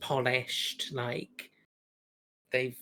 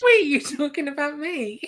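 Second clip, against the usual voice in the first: higher and louder, startled, half-laughing; surprised; 12 semitones higher, 7 dB louder than usual. Real, not acted. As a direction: catch yourself off guard, amused. Surprised she is teased.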